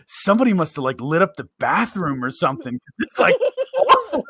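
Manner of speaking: loud, rising to high disgust; coworker's loud disgusted complaint; mock loud complaint. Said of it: "somebody was like".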